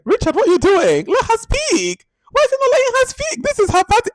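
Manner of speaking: hammed-up shocked partygoers; indignant guests. Guests scolding Richard at the party, very high hammed-up outrage. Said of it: "the guests are like".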